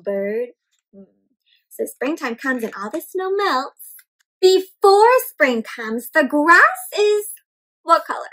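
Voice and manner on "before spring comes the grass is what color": slow, louder question read-aloud; storybook reading. A narrator's reading. Teacher reading to children and prompting their answer, slowly. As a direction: invite the kids to answer, drawn out.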